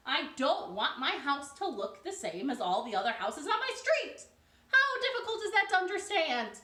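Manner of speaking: loud, high frustrated shouting; neighbor-weary homeowner, shouting and high; exasperated outburst. A narrator's reading. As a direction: rant at neighbors who copy everything. Mister Pine's outburst at copying neighbors.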